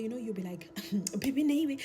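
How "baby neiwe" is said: higher and louder, gushing; wife rushing to greet husband, excited; eager greeting. Retold or imitated. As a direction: call out to him all excited.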